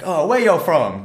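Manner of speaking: high, slow Texan drawl; Texan accent. Retold or imitated. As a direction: imitate a friendly Texan lady asking. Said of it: "exactly that accent".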